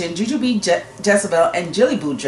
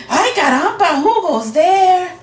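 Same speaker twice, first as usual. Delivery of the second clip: high, loud little hero's challenge; challenging call; 12 semitones higher, 5 dB louder than usual. A narrator's reading. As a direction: call out to the strangers high and bold. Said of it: "asked Skippy John Jones".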